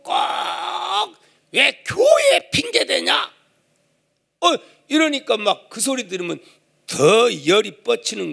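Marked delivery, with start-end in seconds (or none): 0.0-3.3 slow, high shouted comeback; angry retort. Retold or imitated. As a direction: fire it back at him. Wife retorts in quarrel. (아내가 남편에게 쏘아붙이듯 천천히 소리쳐)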